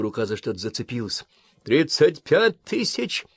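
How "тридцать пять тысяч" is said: high, slow called-out altitude; pilot calling out. Acted. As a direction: call the number over the noise. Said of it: "сообщил пилот".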